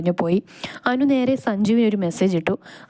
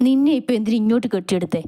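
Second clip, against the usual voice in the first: ordinary delivery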